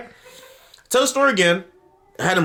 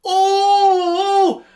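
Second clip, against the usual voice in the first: long falsetto hoot; sustained, very high celebratory "oooh"; high drawn-out "ooh"; 4 dB louder than usual. Pretend. Celebrates being speechless again.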